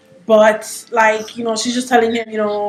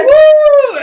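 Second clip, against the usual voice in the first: much higher, louder whoop; imitated crowd cheer; 5 dB louder than usual. Whole audience answering her cheer, loud whoop. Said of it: "everybody would be like".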